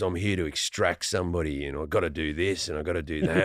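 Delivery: very low, hushed action-hero voice; deep, quiet, tough Australian actor rattle; Chris Hemsworth. Acted. Action star arrives to extract somebody, deep tough actor voice. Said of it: "Hemsworth comes in, he goes".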